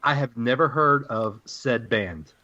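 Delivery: usual speaking voice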